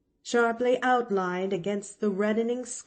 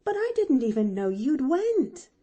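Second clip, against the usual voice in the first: higher, louder protest; crying out; 7 semitones higher, 6 dB louder than usual. A narrator's reading. Nancy protests the girl's slipping away, crying out. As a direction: exclaim it in dismay. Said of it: "cried Nancy".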